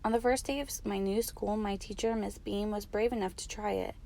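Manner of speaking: ordinary delivery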